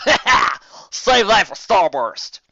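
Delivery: loud high laugh into a slow jibe. Acted. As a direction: gloat through the laugh. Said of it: "Acorn Jade said with a laugh".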